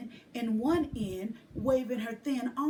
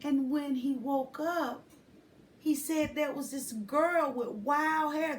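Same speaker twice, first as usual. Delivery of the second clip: ordinary delivery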